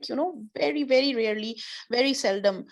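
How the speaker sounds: normal talking voice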